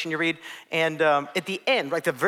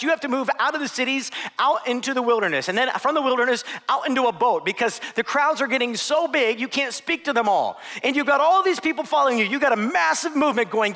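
higher, louder eager pitching; 7 semitones higher, 4 dB louder than usual. Acted. Disciple urging Jesus to recruit the crowds, eager and loud. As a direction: sell Jesus on a growth plan. Disciple pleading for growth strategy.

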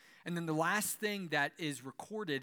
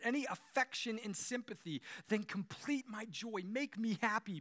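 higher, deliberate reading voice, slowing at the end; scripture reading; 4 semitones higher than usual. Acted. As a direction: read it out to the congregation with weight. Reads scripture aloud.

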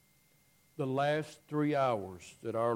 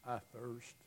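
faint; 3 semitones lower, 12 dB quieter than usual. Retold or imitated. Dying Jesus voiced.

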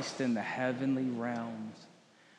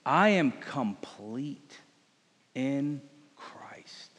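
whisper; slower than usual. Acted. Whispered repeat of affirmation.

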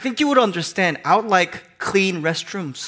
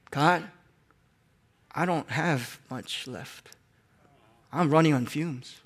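much softer, slow, drained prayer; weary prayer; 3 semitones lower, 9 dB quieter, slower than usual. Acted. A tired believer confessing to God, soft and slow. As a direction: pray as if running on empty.

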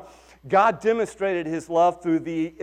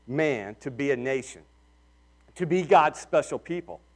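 ordinary delivery

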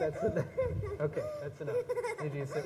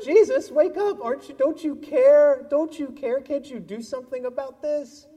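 high, loud frightened cry to a sleeping Jesus; panicked plea; 9 semitones higher, 8 dB louder than usual. Acted. Disciples in the storm begging Jesus to wake, frightened high pleading. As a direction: cry out in mock alarm, higher and louder. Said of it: "They all thought".

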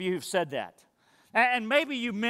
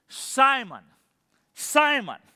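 earnest calling out; 5 semitones higher, 5 dB louder, much slower than usual. Acted. Jesus calling Peter by name.

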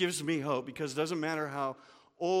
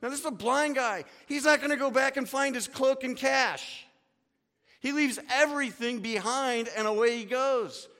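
high, louder excited telling; dramatic; 9 semitones higher, 5 dB louder than usual. Real, not acted. Preacher telling the congregation the beggar's dash, excited. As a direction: build the drama as he runs. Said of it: "it gets so dramatic".

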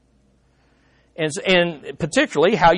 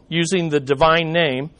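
ordinary talk, nothing marked

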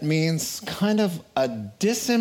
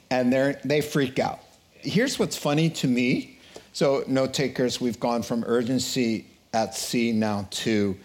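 ordinary talk, nothing marked

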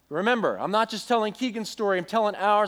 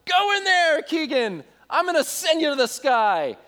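put-on mischievous little boy; 8 semitones higher than usual. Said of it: "he's like".

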